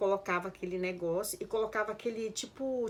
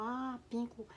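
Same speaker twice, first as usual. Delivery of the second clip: soft, raised "Ah, pinco"; learner's echo; 4 semitones higher, 6 dB quieter, slower than usual. Retold or imitated. Learner answering her Japanese teacher, soft echo. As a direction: repeat PINCO quietly, as if taking it in. Learner echoes the new word.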